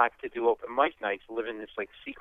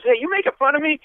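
much higher, louder aggrieved heckle; bleeding drunk snapping at the comic; drunk taking offence; 13 semitones higher, 5 dB louder than usual. Retold or imitated. Heckler yelling at the comic, high and loud. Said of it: "He goes".

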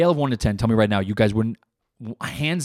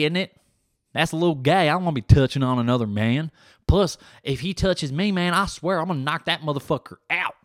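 blustering athlete; 5 semitones higher than usual. Retold or imitated. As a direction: bluster like a tough guy refusing. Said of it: "He's like".

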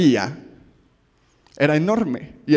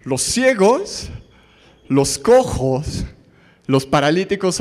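slow emphatic list; much slower than usual. Shown as a demonstration. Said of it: "los agrupa".

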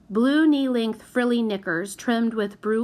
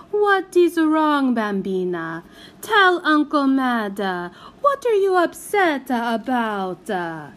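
put-on Italian-accented uncle, high and wheedling; Italian uncle cooing at the niece; coaxing Italian uncle; 3 semitones higher, 4 dB louder than usual. A narrator's reading. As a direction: sweet-talk the little girl in a thick Italian lilt.

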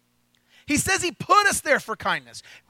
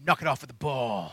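low, soft, laughing command from a big guard; gruff guard order; 11 semitones lower, 6 dB quieter than usual. Retold or imitated. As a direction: tell the kid off lazily, deep down. Guard voiced telling kid off.

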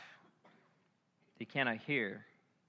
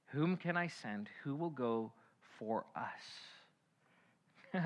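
softer, fading to a whisper on "for us"; quiet echo; 2 semitones lower, 11 dB quieter, much slower than usual. Acted. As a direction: let it fade away. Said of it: "The voice of God".

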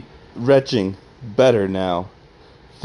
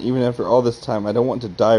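ordinary delivery, nothing marked

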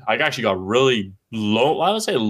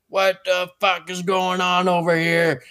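angry neighbor; 9 semitones higher than usual. Retold or imitated. Neighbor voiced in retold story.

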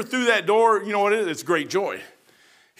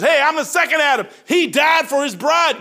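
shouted, higher voice of God; God proclaiming; 7 semitones higher, 6 dB louder than usual. Retold or imitated. God shouting to Mike about Adam and Eve, high and loud. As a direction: preach it as God at full force.

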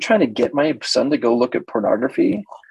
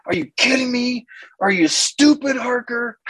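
high, put-on outraged boy voice; indignant teen boy; 14 semitones higher than usual. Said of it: "They're like".

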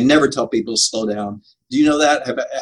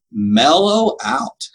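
slow, stretched, slightly raised delivery; drawn-out mellow; 4 semitones higher, much slower than usual. Acted. Preacher reading his own note to calm down, drawn-out mellow. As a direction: draw out the note to yourself. Reads out his mock self-note, laughs after.

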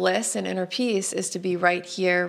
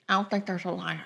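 Pamela Pumpkin voice; 5 dB quieter, faster than usual. Acted. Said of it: "I don't know why I'm talking like that".

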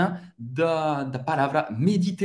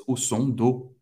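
normal talking voice

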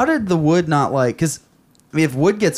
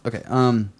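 ordinary talk

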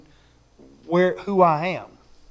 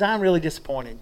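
low, soft let-down sigh of a voice; soft disappointed quote; 4 semitones lower, 7 dB quieter than usual. Retold or imitated. A parent quietly voicing disappointment in a child. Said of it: "you start having those".

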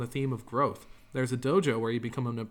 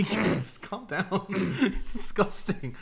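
laughing; 6 semitones higher, much slower than usual. Real, not acted. Laughing at friend's gurgling.

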